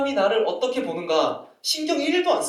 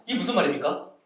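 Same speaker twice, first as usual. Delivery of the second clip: normal talking voice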